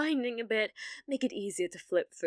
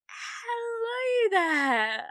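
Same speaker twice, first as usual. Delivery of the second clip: startled fake greeting; 11 semitones higher, 8 dB louder, much slower than usual. Caught intruding, fake cheer.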